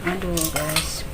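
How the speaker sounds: soft, strained from the chili; quietly suffering the spice; mouth burning from spicy food. Real, not acted. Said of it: "Pedes banget nih guys".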